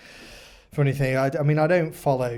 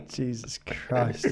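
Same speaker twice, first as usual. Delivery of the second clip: ordinary delivery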